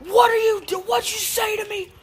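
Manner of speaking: high, loud, shocked scolding; imitated outraged mom. Mom to cursing kid in back seat, high outraged shout. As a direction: shriek it as a scandalized parent, dragging out the end.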